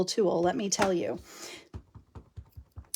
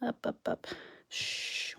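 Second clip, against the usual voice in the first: much softer, breathy hush while concentrating; hushed lining up; 3 semitones higher, 14 dB quieter, slower than usual. Real, not acted. Crafter hushing viewers while lining up stamps, whispered. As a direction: hush it down as you line up the stamp. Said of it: "we have to be very quiet".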